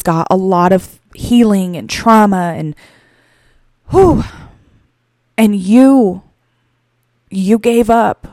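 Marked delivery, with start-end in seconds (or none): 3.9-4.2 high, sharp whew to steady himself; emotional exhale. Real, not acted. Teller overcome by the boy's trauma, emotional exhale. Composing himself mid-story. 5.4-8.3 halting, with long emotional pauses; choked up. Real, not acted. Telling a selfless man what he did, choked up. Choking up over the man's sacrifice.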